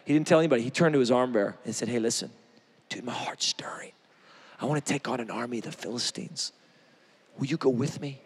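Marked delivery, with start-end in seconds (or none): 1.9-8.2 low, soft conspiratorial whisper; hushed whisper. Retold or imitated. Jonathan whispering a secret plan to his armor bearer, hushed.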